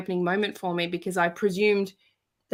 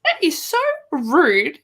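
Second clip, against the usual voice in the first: mock indignant; 9 semitones higher, 6 dB louder, slower than usual. Fortune teller dismisses boyfriend.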